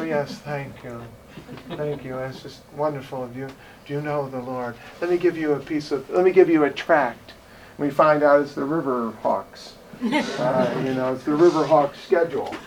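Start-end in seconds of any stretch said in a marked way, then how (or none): 0.0-7.3 put-on quiet, overly polite British accent. Evangelist handing out a tract in a mock British accent. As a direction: play a prim British tract-giver. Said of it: "what's with the British accent either".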